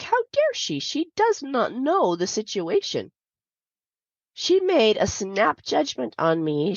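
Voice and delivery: high, indignant retold outrage; livid. To herself about the neighbor who yelled, high indignant outrage. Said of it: "I was livid".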